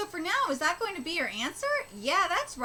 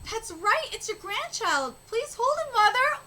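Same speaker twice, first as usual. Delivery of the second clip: ordinary delivery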